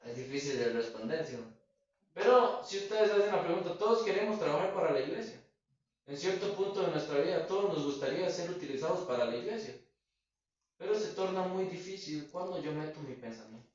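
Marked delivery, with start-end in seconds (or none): 2.1-13.0 high, fervent sermon appeal; preaching appeal. Acted. Preacher rallying the brethren to serve the church, fervent and high. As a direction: lift the congregation with it.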